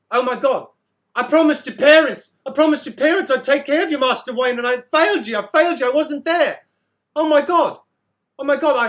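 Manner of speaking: anguished, loud British outburst; high, distraught British confession, pleading; Michael Caine. Acted. Guardian confesses failing Master Wayne, distraught British accent. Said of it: "I sound like Michael Caine".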